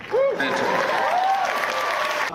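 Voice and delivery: very slow, stretched villain purr; Phantom Limb voice. Shown as a demonstration. Actor performs his villain's name on request, drawn out. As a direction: savour the name like a smooth villain. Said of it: "low register ... very close to the microphone".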